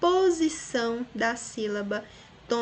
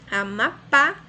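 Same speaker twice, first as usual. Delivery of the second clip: Amapá louder, rising onto a strong final pá; stress on last syllable; 6 dB louder, slower than usual. Shown as a demonstration. Teacher repeating the example, Amapá stressed on final pá. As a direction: land hard on the pá of Amapá. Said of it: "a sílaba mais forte".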